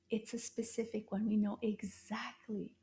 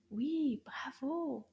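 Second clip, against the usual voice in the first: whispered praise; slower than usual. Real, not acted. Praising the pupil softly.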